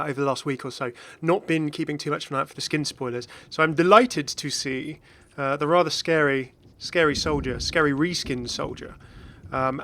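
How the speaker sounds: ordinary talk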